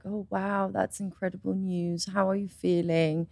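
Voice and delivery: low, soft, measured reassurance; gentle stepmother. Retold or imitated. Stepmother responding to pregnancy news, soft low calm.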